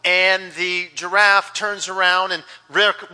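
usual speaking voice

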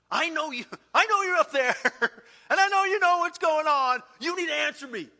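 pushy, loud demand up high; preacher retelling his demand of God. Retold or imitated. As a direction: insist God answers you. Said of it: "boy, don't talk to God like that".

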